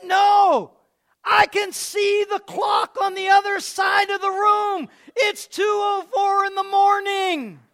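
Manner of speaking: high, loud, slow insistence; excited shout. Retold or imitated. Father insisting to mother he can see, shouting. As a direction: shout the proof of healing.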